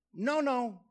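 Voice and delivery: ordinary delivery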